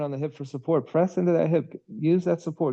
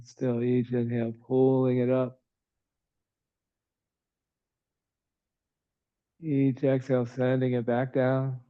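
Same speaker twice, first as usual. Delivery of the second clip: calm, even yoga-teacher voice with long pauses; soothing, evenly paced breath cues; guided breathing meditation; 2 semitones lower, 3 dB quieter than usual. Real, not acted. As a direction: guide the breath gently, in an unhurried monotone.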